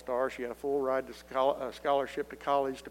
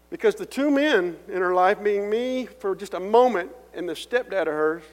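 loud, higher, pleading emphasis; impassioned preaching; 6 semitones higher, 9 dB louder than usual. Acted. To the congregation about his daughter, impassioned preaching. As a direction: hammer each line home with feeling.

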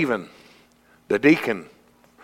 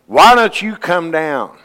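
higher, louder, slow taunting call; crowd's mocking jeer; 8 semitones higher, 6 dB louder, slower than usual. Crowd mocking Jesus on the cross, loud slow taunting. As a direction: taunt him like a jeering crowd. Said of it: "Most of the crowd was mocking him".

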